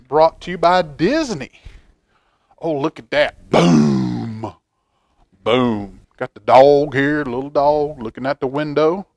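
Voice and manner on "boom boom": deep rumbling boom, falling away; low thunder boom. Acted. Showing the thunder picture to children, low rumbling boom.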